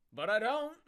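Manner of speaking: high loud punchline. Pretend. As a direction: blurt the punchline. Punchline jump.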